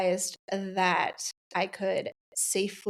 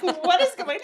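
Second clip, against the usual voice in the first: mock panic; 10 semitones higher, 5 dB louder than usual. Neighbor could have panicked.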